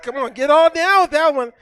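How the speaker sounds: ordinary delivery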